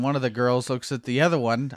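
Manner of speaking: ordinary talk, nothing marked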